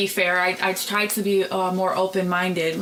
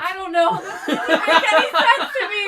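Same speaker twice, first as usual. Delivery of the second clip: high, laughing disbelief; 9 semitones higher, 4 dB louder than usual. Real, not acted. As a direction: laugh through it, much higher. Laughing reaction to enema story.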